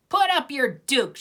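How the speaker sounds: high, loud call to fight; fight challenge. A narrator's reading. Lucy challenging Snoopy at his doghouse, loud and high. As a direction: dare him to fight. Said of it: "she cried".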